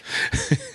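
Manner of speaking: airy giggle up high; high breathy giggle. Real, not acted. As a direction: let a soft high giggle escape. Laughing at own prank story.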